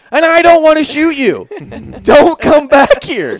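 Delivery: high, loud retold outburst; exasperated yelling. Homeowner warning the intruder off, exasperated loud re-enactment. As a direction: shout him down, at the end of your tether.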